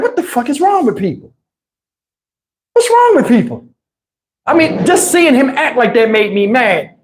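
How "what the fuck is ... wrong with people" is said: rising to a high, disbelieving yelp; high, far exasperated squawk. Retold or imitated. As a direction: throw up your hands in disbelief. Exasperated outburst.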